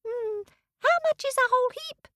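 very high, fast squeaky ant; tiny ant. Acted. The ant bargains.

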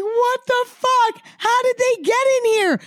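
high panicked yell at the sight of the glasses; panicked screaming. Retold or imitated. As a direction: shriek it high like you can't believe your eyes. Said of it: "I'm, like, screaming at her".

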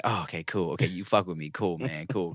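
soft, low, mock-relieved cool; mild imitation. Father voicing his son's grudging acceptance, soft and low. Baby finally accepting him, voiced.

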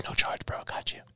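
soft, whispered favour to a buddy; confiding aside. Retold or imitated. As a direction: slip it to him under your breath. Retold confiding aside to customer.

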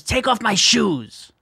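high, slow, drawn-out grandma order; demanding grandma. Retold or imitated. Soaking-wet grandma ordering grandson, demanding.

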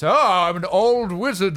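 lightly voiced slow old wizard; a hint of a doddering wizard, slowly.

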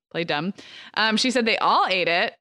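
ordinary delivery

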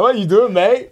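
hammed-up Aussie mate voice; bad Australian accent. Co-host mimics Ricciardo teasing him, in bad Australian accent. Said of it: "That was the worst Australian accent".

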